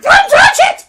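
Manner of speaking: very high, loud screech; screaming child. Retold or imitated. Child stopping teacher touching her bag, screaming. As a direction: shriek it like a child in a tantrum. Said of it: "She's just screaming".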